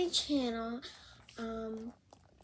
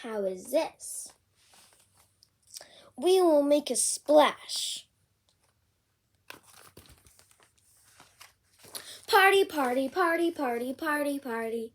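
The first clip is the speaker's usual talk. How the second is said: lively picture-book read-aloud; bouncy storybook reading; reading to children; 4 semitones higher, 6 dB louder than usual. Acted. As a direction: read it out with big character voices for a kid.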